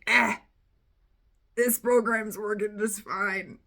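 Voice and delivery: loud, drawn-out shrug; mock-complacent, stretched-out insistence; dismissive brush-off. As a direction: wave the worry away loudly and slowly. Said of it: "I would just be like".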